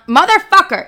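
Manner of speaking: loud, high swearing at a pest; sudden real burst of irritation; annoyed outburst. Real, not acted. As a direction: yell it at the bug that interrupted you. Swearing at a bug mid-sentence.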